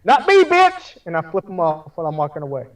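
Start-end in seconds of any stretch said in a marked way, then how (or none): 0.0-1.0 defiant yell. Acted. Player yells defiance.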